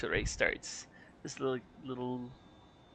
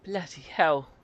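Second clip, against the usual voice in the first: put-on British accent; 4 semitones higher, 4 dB louder than usual. Mock-British correction of the listener.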